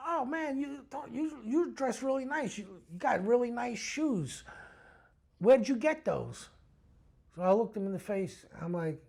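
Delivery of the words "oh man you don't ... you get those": higher, chatty imitation; put-on admiring voice, pitched up; overfriendly stranger. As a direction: lay on flattery like a suspicious stranger.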